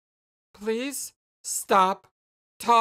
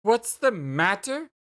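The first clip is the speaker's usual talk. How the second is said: slow, incredulous echo; seething disbelief; 3 semitones higher, 3 dB louder, slower than usual. Acted. Jane echoing Jake's question, seething disbelief. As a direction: throw the question back, anger held in.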